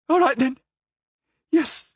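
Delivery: high, eager cry; cried out. A narrator's reading. Prisoner crying out her agreement, high and eager. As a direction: give in with a cry. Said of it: "she cried".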